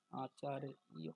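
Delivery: আচার্য broken into ā-chā-ri-ya; Sanskrit pronunciation. Shown as a demonstration. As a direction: teach আচার্য syllable by syllable. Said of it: "সংস্কৃত উচ্চারণ কি আছে". (আচার্য ভেঙে সংস্কৃত উচ্চারণে)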